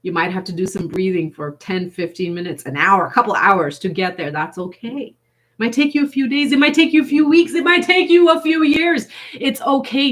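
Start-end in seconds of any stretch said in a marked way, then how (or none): 5.2-10.1 higher and louder, building through days, weeks, years; rising emphatic reassurance. Acted. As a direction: lift each line higher to say any time is fine. Reassuring that any time is okay.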